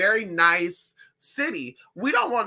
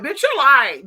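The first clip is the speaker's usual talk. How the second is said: higher, louder "you're lying"; mock accusing shout; 8 semitones higher, 8 dB louder, slower than usual. Telling viewers about a spooky voice, mock shout. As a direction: snap at mom in disbelief. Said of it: "And you're like".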